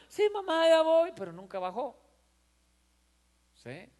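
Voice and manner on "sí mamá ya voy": much higher, sing-song brush-off; dismissive kid. Retold or imitated. Son answering his mother without meaning it. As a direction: promise and don't mean it. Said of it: "El muchachito no hizo caso".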